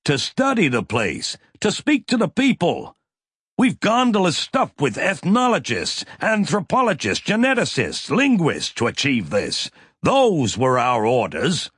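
sharp, high, angry retort; snapping. A narrator's reading. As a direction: snap back in outrage. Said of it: "Mac snapped".

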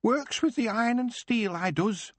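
high, bright-spirited goblin introducing himself; chirpy little goblin; cheerful goblin. Acted. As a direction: goblin proudly giving his name. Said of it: "the goblin said cheerfully".

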